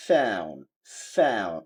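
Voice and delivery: American "found" with AE-oo and dropped D; American accent. Shown as a demonstration. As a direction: say "found" as falling FAE-oon. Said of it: "ওরা বলছে". (American উচ্চারণে)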